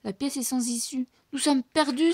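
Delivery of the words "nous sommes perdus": higher, louder cry; cry of despair. A narrator's reading. Bianca cries out they are lost, higher and louder. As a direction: cry out trapped. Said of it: "s'écrie Bianca".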